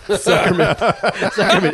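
laughing, calling the friend's name; laughing while telling a friend. Real, not acted. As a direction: call his name through a laugh.

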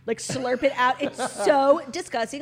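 drawn-out disgust. Retold or imitated. Imitating friend's slurping, disgusted.

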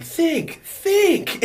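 high-pitched cartoon brainstorming cry; Jimmy Neutron. Kid racking his brain at mom's door, cartoon impression. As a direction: squeeze each word out high and urgent. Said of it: "Jimmy Neutron".

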